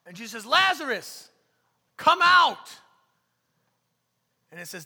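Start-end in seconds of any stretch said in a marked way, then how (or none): 0.5-2.7 high, very loud shout; soaring, commanding yell; loud call. Acted. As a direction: holler the summons with full force. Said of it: "called out in a loud voice".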